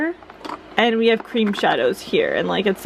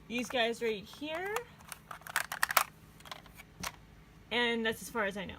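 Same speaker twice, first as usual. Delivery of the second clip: into a fan; 16 dB quieter than usual. Real, not acted. I apologize about the fan noise.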